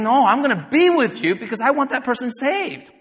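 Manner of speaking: loud, high, earnest promise; voice of God. Retold or imitated. Preacher voicing God's promise to the believer, loud and high. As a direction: declare it like a divine promise. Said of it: "God is saying".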